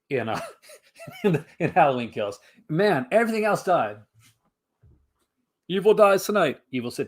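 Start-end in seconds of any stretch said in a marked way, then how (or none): 5.7-6.6 higher and louder, a mock movie-trailer proclamation; dramatic line read. Hammed-up movie line read to the chat before a joke. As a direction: declaim it like a trailer tagline.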